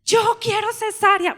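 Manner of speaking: high, loud, slow demand; demanding wail. Retold or imitated. The daughter demands a cesarean from the doctors, high and loud. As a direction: insist desperately on the cesarean.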